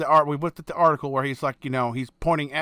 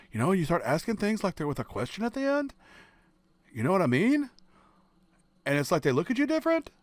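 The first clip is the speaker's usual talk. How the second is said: Californian uptalk; 4 semitones higher, 3 dB quieter than usual. Shown as a demonstration. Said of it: "an impersonation of a typical Californian thing".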